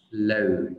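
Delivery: "load" with an "âu" glide and final d; correct vowel. Shown as a demonstration. Teacher modelling the syllable, gliding "load". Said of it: "mình đọc là".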